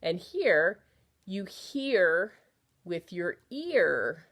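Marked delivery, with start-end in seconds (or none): 1.2-4.2 "ear" said slowly and clearly; slow down and round out "ear"; slow model. Shown as a demonstration. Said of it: "they sound like ear to me".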